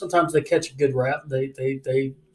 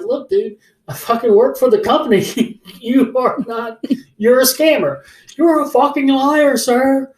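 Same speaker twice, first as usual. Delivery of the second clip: raised, high, loud retelling of a row; indignant, building in pitch and volume; angry argument; 9 semitones higher, 7 dB louder, slower than usual. Retold or imitated. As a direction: act out telling off a scammer. Acting out his phone quarrel.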